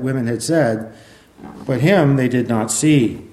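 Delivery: projected reading aloud with firm emphasis; scripture reading. Acted. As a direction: proclaim the passage to a congregation. Reads scripture aloud to congregation.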